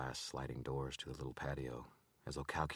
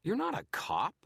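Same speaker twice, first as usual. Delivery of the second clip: much higher, loud accusation; accusing; 9 dB louder than usual. A narrator's reading. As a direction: catch him out, outraged. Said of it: "He jerked his wallet back and accused".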